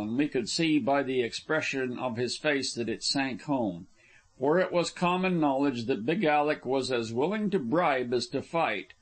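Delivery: ordinary delivery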